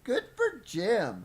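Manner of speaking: loud, high, drawn-out cheer; cheering approval. Real, not acted. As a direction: cheer slowly and loudly for the prankster. Cheering the prank mid-reading.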